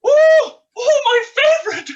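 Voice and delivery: loud, high squeal of delight. Acted. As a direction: squeal over the treat. Dame delighted by found bottle.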